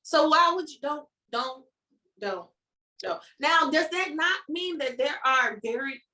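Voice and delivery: ordinary delivery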